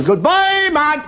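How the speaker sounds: very high mooed farewell; talking cow. Acted. Cow bidding the ventriloquist goodbye, mooed out. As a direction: stretch the farewell into a high moo. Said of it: "and the cow says".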